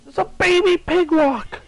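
high, loud excited squeal; delighted squeal. Real, not acted. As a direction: gush over a cute animal. Delighted by a baby creature.

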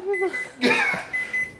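high, breathless laugh after a fall. Real, not acted.

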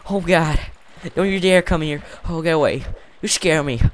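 scared pleading. Real, not acted. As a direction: beg the mob to stay back.

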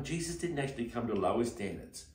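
ordinary delivery

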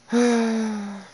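long high exasperated sigh. Real, not acted. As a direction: sigh out loud in frustration. Frustrated at opponent not quitting.

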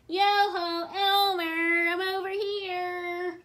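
Wilbur calls to Elmer from hiding, singsong holler; high, loud, drawn-out "yo-ho" call. A narrator's reading. Hidden elephant calls his cousin, loud and teasing singsong. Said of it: "to where the voice came from".

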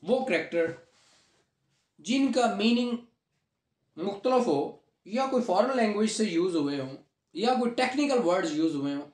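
usual speaking voice

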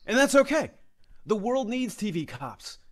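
sarcastic consolation; mock reassurance. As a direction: switch brightly to condescending comfort. Veteran cop sarcastically reassures.

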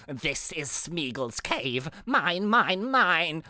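slow, badly faked creature voice; hammed-up possessive cave creature, words drawn out; Smeagol. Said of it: "to witness my Smeagol voice".

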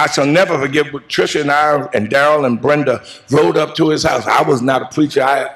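usual speaking voice

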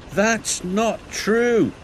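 high, slow, cross denial. A narrator's reading. Thomas hits back at Diesel's insult, crossly. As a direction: stretch it out indignantly, pitched well up. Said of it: "said Thomas crossly".

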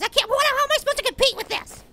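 normal talking voice

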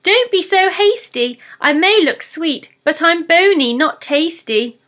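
high, bright pleading pixie voice; squeaky pixie pleading. Acted. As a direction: talk fast to save her skin.

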